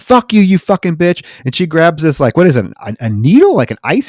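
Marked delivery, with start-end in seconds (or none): 0.0-1.2 higher, louder spat insult; angry insult. Retold or imitated. Captor cursing at the woman, angry and loud. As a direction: spit it back at her. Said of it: "And he's like".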